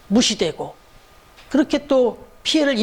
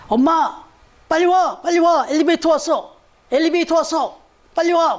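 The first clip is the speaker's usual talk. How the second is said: mock urgent child's call, higher-pitched; retold child's yelling; 6 semitones higher than usual. Child calling mom to the waiting elevator, mock yell. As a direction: call out high like an impatient child, not at full volume. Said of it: "소리를 막 지르더래요".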